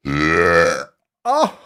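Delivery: loud low belch; loud burp. Real, not acted. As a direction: let out a big real burp. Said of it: "I got a burp coming".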